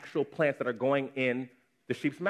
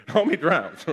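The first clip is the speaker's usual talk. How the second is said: laughing repeat; 5 semitones higher, 7 dB louder, slower than usual. Shown as a demonstration.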